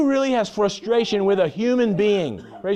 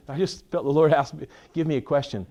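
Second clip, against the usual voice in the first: ordinary talk, nothing marked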